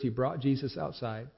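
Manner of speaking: ordinary delivery, nothing marked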